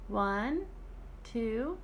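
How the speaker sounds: ordinary talk, nothing marked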